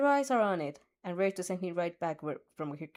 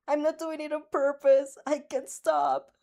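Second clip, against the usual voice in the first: higher, louder pleading, slowing at the end; panicked plea; 7 semitones higher, 5 dB louder than usual. A narrator's reading. Dark Shadow pleads with his master, higher and louder in panic. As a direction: beg helplessly, out of control.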